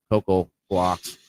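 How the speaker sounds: ordinary talk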